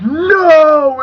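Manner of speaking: high, loud cry of no; a sudden pained No; anguished cry. Retold or imitated. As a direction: cry out in disbelief. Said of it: "You're like".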